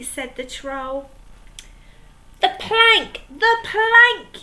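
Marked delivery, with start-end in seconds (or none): 2.4-4.4 much higher, louder chant; bay for it like a mob; yelling. Acted. Pirates yelling at the troll for the plank. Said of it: "yelled Ben, Buckle and Percy Patch".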